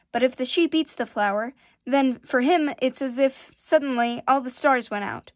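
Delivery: a prince's hurt, loud plea; heated pleading. Acted. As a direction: press the point, close to tears. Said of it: "His face turned red now".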